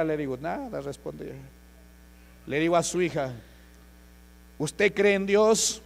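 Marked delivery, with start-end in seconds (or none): 4.6-5.8 loud, slow, raised-pitch question; emphatic question. Retold or imitated. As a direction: re-enact a preacher's challenge, slow and loud. Preacher re-enacts challenging the daughter.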